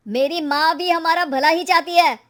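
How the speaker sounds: loud angry retort from a husband; heated, forceful objection; shouting. Acted. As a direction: raise the voice in defence of mother. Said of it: "अरे पर चिल्ला क्यों रहे हो".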